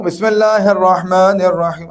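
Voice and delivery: measured, audible Quranic recitation; reciting aloud. Shown as a demonstration. Teacher showing recitation that others hear, recited aloud. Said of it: "لیکن اب میں پڑھ رہا ہوں".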